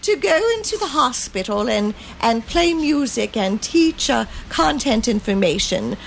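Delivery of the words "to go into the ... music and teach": higher, laughing storybook granny; giggly nursery lady voice; Mother Goose voice. Pretend. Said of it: "you have to do it in Mother Goose's voice".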